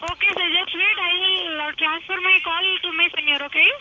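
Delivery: Indian English call center agent; steady Indian accent reading a phone script. Real, not acted. Scammer call center agent talking.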